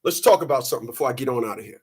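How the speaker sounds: ordinary talk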